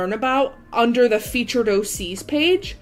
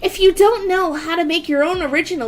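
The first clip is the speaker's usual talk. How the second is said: higher, excited character read; Akira voice; 7 semitones higher than usual. Acted. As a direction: read it bright and bubbly as Akira. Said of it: "continue reading this in, uh, my Akira voice".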